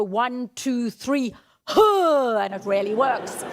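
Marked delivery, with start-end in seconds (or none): 0.0-2.4 loud huh breath release; counted release shout. Shown as a demonstration. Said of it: "and then I go".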